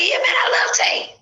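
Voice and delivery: high whispery Kevin impression. Mimicking Kevin to the blue Ranger, high and slow. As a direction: squeeze it up high and airy like a fanboy.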